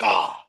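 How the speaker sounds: low "ahh" after a gulp; satisfied drink gasp. Retold or imitated. Friend showing how he chugged the energy drink, low satisfied gasp.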